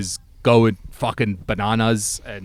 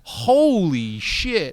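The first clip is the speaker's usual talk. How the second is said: slow, high drawn-out holy shit; drawn-out disbelief; 7 semitones higher, much slower than usual. Real, not acted. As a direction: stretch it out in disbelief. Reacting to the demand.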